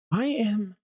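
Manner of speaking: talking through a yawn; words stretched by a yawn. Real, not acted. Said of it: "for the yawn".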